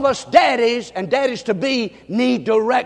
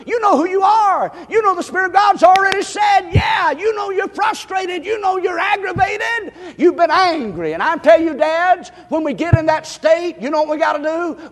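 preaching shout; 7 semitones higher than usual. Acted. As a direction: lift it and call it out to the fathers. Preacher rising to full force.

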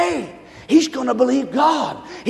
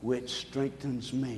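much softer, slow, breathy reciting; hushed recitation; 12 semitones lower, 18 dB quieter, much slower than usual. Shown as a demonstration. Pastor leading the congregation, a hushed recitation. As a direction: say the verse low and slow with the people. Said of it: "say it with me".

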